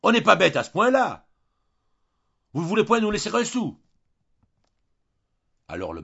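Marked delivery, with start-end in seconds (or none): 0.0-3.8 beugler de rage, très fort et très haut; beuglant de colère. A narrator's reading. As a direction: exploser de colère. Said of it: "beuglant de colère". (Julien beugle de colère contre ses beaux-parents)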